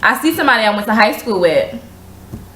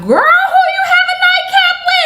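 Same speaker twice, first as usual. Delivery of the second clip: very high excited teasing; gossipy friend; 3 dB louder than usual. Retold or imitated. Friend calling to tease about a nightcap, very high excited voice. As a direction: squeal the gossip.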